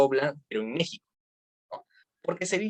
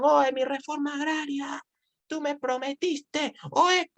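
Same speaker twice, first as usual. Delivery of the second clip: high, pleading peasant voice; protesting peasant; 10 semitones higher than usual. Retold or imitated. Rebel peasant calling out to Madero, high and pleading. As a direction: cry out a broken promise.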